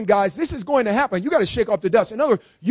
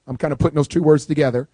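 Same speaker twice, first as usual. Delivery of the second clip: ordinary delivery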